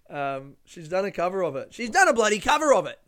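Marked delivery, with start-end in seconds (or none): 1.7-3.0 loud, high, fast exclamation; emphatic outburst. Real, not acted. Host insisting she's done a cover, emphatic outburst. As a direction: blurt it out louder and higher.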